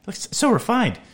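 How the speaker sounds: high-pitched mock gushing; hammed-up dreamy admiration; imitated swooning girls. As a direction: swoon over him in a put-on girlish pitch. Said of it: "all the girls are like".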